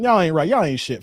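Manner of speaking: usual speaking voice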